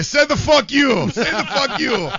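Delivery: loud, high, slow comic bark; Eddie Murphy. Retold or imitated. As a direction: deliver it like his famous bit. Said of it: "Eddie Murphy".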